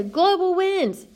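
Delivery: high, slow, dopey "Global winds"; mock wrong answer. Teacher mocking students who give the wrong answer, high dopey voice. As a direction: imitate a clueless student answering confidently. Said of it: "They say".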